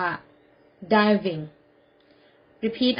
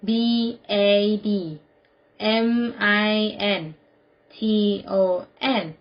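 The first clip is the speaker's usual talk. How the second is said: spelled out. Shown as a demonstration. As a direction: spell it out with a pause after each letter. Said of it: "Spell it together สะกดพร้อมกันนะคะ". (สะกดทีละตัวอักษรช้า ๆ)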